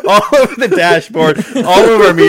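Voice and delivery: ordinary talk, nothing marked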